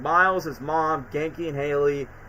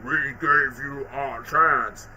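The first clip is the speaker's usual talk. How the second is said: imitating Venom; much slower than usual. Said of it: "and then just be like".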